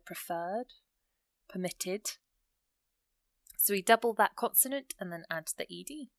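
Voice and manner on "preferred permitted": "preferred, permitted" said softer, each leaning on the second syllable; stress on second syllable. Shown as a demonstration. Teacher listing examples for learners, "preferred" and "permitted" stressed on the second syllable. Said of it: "you can hear the emphasis on the end".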